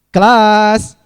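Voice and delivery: loud call for attention; calling the class. Real, not acted. Teacher calling out loudly to get the class's attention. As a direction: call out to the room.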